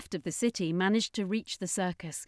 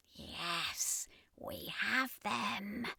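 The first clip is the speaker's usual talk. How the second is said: soft, very slow sinister hiss; menacing villain; 9 dB quieter, much slower than usual. Acted. Hylexan commander gloating over the trapped city, sinister hiss. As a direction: savour the capture.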